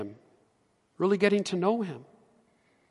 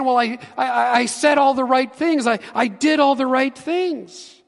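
protesting believer; 9 semitones higher, 6 dB louder than usual. Pretend.

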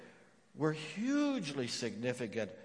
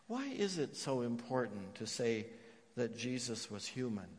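ordinary delivery

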